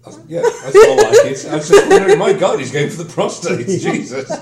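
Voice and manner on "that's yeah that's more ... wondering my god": talking through big laughs; laughing. Real, not acted. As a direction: laugh the words out high.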